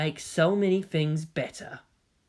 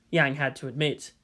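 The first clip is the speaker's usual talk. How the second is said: usual speaking voice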